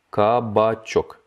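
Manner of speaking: кабачок said slowly, every syllable clear; slow teaching repeat. Shown as a demonstration. Teacher slowing кабачок down for learners. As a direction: stretch кабачок out. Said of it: "slow repeat of vocabulary".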